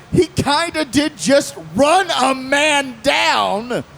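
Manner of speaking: slow, hammed-up objection; mock lawyerly shout. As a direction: drag it out like a courtroom protest. Mock lawyer argument to cops.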